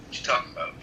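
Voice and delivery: ordinary delivery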